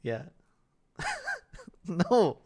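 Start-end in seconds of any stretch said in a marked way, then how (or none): 1.0-1.8 short high-pitched giggle; amused high giggle; high laugh. Real, not acted. Laughing at the concussion joke.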